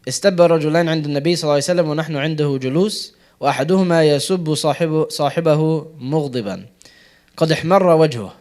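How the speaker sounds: quick classical Arabic hadith reading; hadith recitation. Acted. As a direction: rattle off the narration in Arabic, fast and firm.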